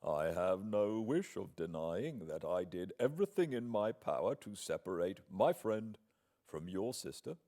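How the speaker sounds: outwardly calm, coolly defiant; assumed tranquillity. Acted. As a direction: answer with proud, cool composure. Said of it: "With assumed tranquillity".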